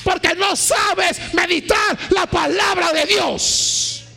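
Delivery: loud, high fiery preaching; preaching at full force. Acted. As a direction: thunder it from the pulpit. (predicación a todo pulmón)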